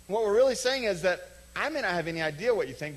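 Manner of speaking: ordinary talk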